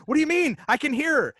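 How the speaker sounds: high, loud retort as the frantic wife; urgent retort. Retold or imitated. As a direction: fire back in alarm, imitating the wife. Wife's urgent retort, retold in quarrel.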